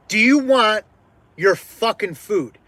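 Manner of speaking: emphatic, exasperated challenge; fed-up anger. Retold or imitated. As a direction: re-enact losing patience with a customer. Acts out snapping back at customer.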